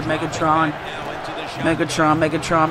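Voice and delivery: low murmured repetition; low, restrained build-up; muttered anticipation. Real, not acted. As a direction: murmur the name low, like a chant. Muttering receiver's name before the play.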